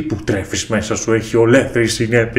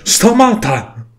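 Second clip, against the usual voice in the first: urgent command; 12 semitones higher, much slower than usual. Acted. Sage orders him to stop.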